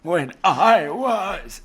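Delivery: strained, raspy, very slow voice; RFK Jr voice. Retold or imitated. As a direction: squeeze it out slowly as if the throat hurts.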